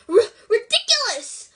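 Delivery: high, loud nervous squeak with a stammer. A narrator's reading. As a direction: squeak it out, stumbling on the start. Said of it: "squeaked Neville".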